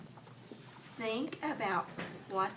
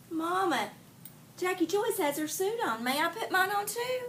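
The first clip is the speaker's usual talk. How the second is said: higher, lightly voiced girl asking sweetly; girl pleading with Mama; 7 semitones higher than usual. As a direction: coax Mama like a little girl. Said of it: "And she goes".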